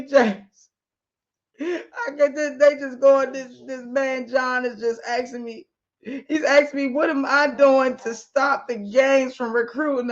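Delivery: distraught crying. Acted. Wife calls husband in tears, distraught and pleading. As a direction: cry out for your husband to come. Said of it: "His wife is calling him all distraught".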